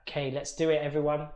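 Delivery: usual speaking voice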